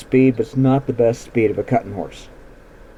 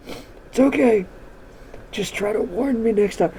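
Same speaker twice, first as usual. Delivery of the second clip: reassuring mom; 7 semitones higher, 4 dB quieter than usual. Retold or imitated. Mom comforting the horse.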